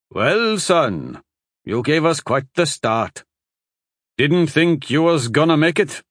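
lightly voiced gruff old husband; a folksy old man, relieved.

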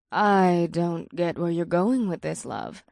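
low, soft, slow purr; succubus purr. A narrator's reading. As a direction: purr it, puzzled and teasing. Ada's purring voice again.

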